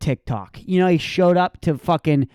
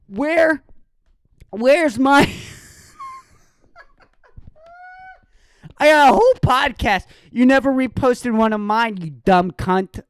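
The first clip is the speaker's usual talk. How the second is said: mock outraged rant; 10 semitones higher, 3 dB louder than usual. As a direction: yell it like a jilted ex hamming it up.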